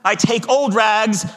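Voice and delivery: high, loud street call; ringing ragman's cry. A narrator's reading. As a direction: cry the wares out slowly and high. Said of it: "cried the sobbing, bleeding, strong".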